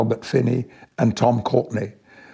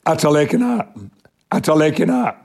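Yorkshire dialect; 4 semitones higher than usual. Shown as a demonstration. Calling a friend out to play, in broad Yorkshire dialect. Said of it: "I spoke in dialect".

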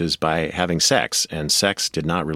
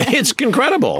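squeaky, amazed burst of disbelief; amazed exclamation; 4 dB louder than usual. Real, not acted. Guest marvels at the network's absurd caution, amazed. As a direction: hit it way up high, marvelling.

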